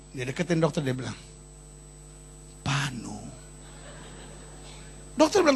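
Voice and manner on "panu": low, soft confession of a shameful ailment; patient admits it under the breath; embarrassed whisper. Acted. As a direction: barely say it, ashamed to admit.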